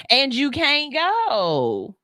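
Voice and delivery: you can't go stretched very slow, go rising; slow drawn refusal. Shown as a demonstration. Mom talking at the kids' level, slowly drawn out. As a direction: drawl it out slowly. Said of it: "get on these kids' level".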